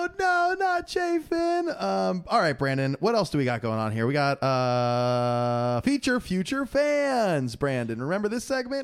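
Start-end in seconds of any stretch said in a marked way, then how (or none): 0.1-1.7 high, slow hammed-up wail; mock despair. Host mocking fans mourning the traded reliever, hammed-up wail. 5.8-7.6 segment announcement. Acted.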